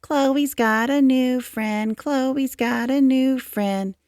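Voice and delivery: slow, high, teasing chant; singsong taunt. A narrator's reading. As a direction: taunt her like a playground bully. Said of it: "Andrew whispered".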